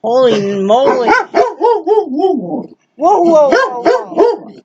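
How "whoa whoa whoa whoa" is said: high wavering whoas to the dog; excited sing-song whoa. Real, not acted. Greeting the jumping dog, excited sing-song. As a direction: ride the whoas up and down.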